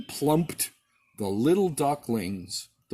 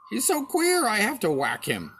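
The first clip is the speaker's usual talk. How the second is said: a high, cheeky duck voice; pert little duck; 7 semitones higher, 4 dB louder than usual. A narrator's reading. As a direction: squeak it out smug and spiteful. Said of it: "said the pert little Mister Duck".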